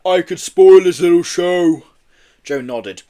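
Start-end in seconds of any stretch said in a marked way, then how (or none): 0.0-1.8 loud, slow, drawn-out threat; big creature itching to make trouble; growling yeti. A narrator's reading. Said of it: "growled Marbo".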